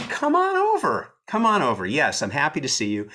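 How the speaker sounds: mock-cheerful invite to an angry caller; mocking invitation. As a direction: dare him to come, teasingly. Taunting the caller, laughing.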